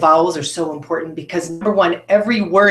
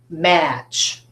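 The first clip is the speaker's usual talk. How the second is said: ordinary delivery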